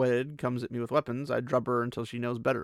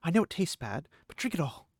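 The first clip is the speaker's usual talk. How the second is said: firm; 5 semitones higher than usual. A narrator's reading. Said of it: "she said firmly".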